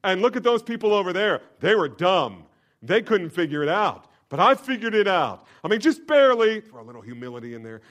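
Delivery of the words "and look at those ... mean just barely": high, loud, self-satisfied mock boast; smug boast. Proud believer in heaven boasting, mock smug. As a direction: play a proud fool congratulating himself. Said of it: "stand in heaven and say".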